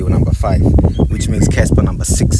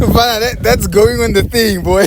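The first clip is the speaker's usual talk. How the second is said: high-pitched, tickled laughing delivery; laughing excitedly; 12 semitones higher than usual. Real, not acted. Co-host reacting to a guest's bold verdict, laughing hard. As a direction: laugh through it, voice shooting up high.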